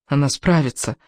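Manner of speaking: plain reading voice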